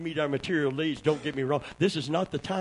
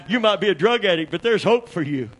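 high, loud shouted preaching; climbing sermon shout; preaching at full force; 6 semitones higher, 8 dB louder than usual. Acted. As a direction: whoop it up like a revival preacher. Preaching builds again.

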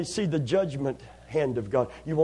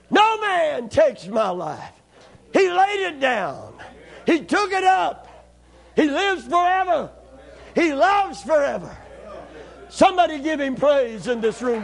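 rising, ringing pulpit cries building to shouts; full-force preaching; 8 semitones higher, 4 dB louder than usual. Acted. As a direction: preach it to the rafters, driving each line home.

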